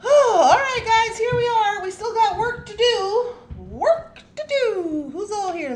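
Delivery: loud, high rallying call to the group. Real, not acted. Streamer rallying viewers for work, calling out loudly. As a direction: belt it out high to get everyone working.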